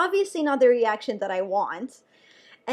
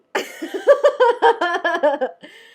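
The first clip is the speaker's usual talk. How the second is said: higher, louder burst of laughter at herself; embarrassed laugh; 7 semitones higher, 10 dB louder than usual. Real, not acted. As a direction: let out a sheepish laugh. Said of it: "And I felt so silly".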